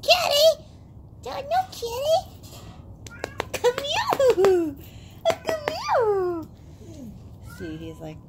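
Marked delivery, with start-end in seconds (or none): 0.0-2.2 high, loud pretend-dog voice; voicing the dog. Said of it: "You're like". 3.5-7.6 high, excited call to a dog; coaxing call. Real, not acted. Calling the dog over.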